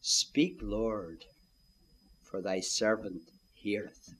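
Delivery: low, soft and slow, bowing to God; hushed humble prayer. Shown as a demonstration. Preacher showing the listener how to answer God: soft, low and humble. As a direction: breathe it like a humble servant. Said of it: "here's what to do. Say".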